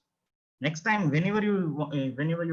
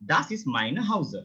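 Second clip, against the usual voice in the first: normal talking voice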